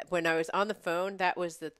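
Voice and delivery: usual speaking voice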